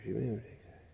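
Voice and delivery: ordinary talk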